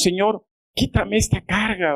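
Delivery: high-pitched imitated plea; acted pleading prayer. Believers asking the Lord for relief, acted pleading. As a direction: plead as a burdened believer would.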